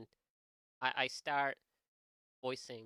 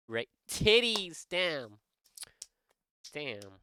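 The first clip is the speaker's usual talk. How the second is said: ordinary talk, nothing marked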